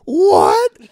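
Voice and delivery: angry what. Retold or imitated. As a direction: bark it at the girl like a drunk. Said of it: "I fucking go".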